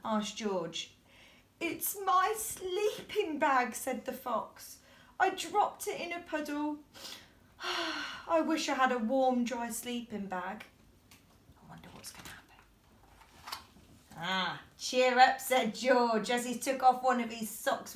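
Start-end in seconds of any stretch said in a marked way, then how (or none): 1.6-3.8 slow, high crying fox; a weepy fox, mildly tearful; sad fox. A narrator's reading. 5.2-10.6 wistful weepy wish; crying fox wishing aloud; sad fox. A narrator's reading. 11.6-13.6 hushed whisper aside; softly whispered aside. Real, not acted. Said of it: "aside to listening child". 14.2-15.4 gentle cheer-up. A narrator's reading. George comforts fox.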